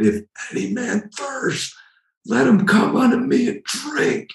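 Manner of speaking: crying out. Acted. Preacher acts Jesus calling to the feast crowd, crying out. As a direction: imitate crying out at the top of the voice, without full volume. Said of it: "crying out with all his might".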